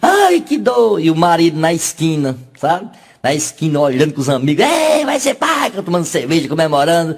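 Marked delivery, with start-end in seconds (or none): 0.0-1.0 high, drawn-out labour wail; mock cry of agony, pitched high; crying out in pain. 4.7-5.7 high, drawn-out whooping cheer; hammed-up festive call; celebrating. Husband cheering with friends.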